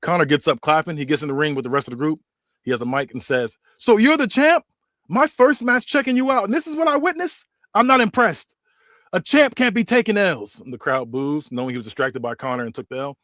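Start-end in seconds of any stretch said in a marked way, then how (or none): 3.8-10.6 high, loud trash-talking wrestler voice; cocky heel promo. Acted. Connor mocking the champ on the mic, cocky high heel voice. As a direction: sneer it at the champ like a smug heel.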